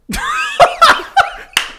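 loud high laughter. Real, not acted. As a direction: burst out laughing. Laughs at own story.

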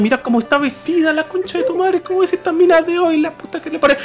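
high, outraged gossip voice; mock-scandalized muttering about her clothes; sexist comments. Fluffy gawks at the neighbour, high and scandalized. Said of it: "se manda tremendos comentarios machistas".

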